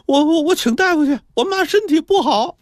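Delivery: very high, stammered plea; frantic child. Acted. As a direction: stutter it out urgently as a panicked boy. Frantic boy begs landlady for help.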